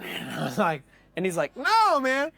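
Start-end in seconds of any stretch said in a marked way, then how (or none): 1.6-2.3 high, loud retold denial; emphatic denial. Musician denying the cocaine rumour, high loud imitation. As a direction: push back loudly, pitched right up.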